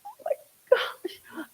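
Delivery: breathy, emotional oh my gosh; overwhelmed, voice catching; choked-up gasp. Real, not acted. Moved by necklace memory.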